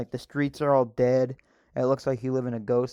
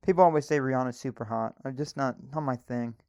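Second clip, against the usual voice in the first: ordinary talk, nothing marked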